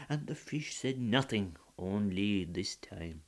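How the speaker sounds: ordinary delivery, nothing marked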